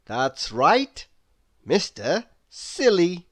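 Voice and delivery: usual speaking voice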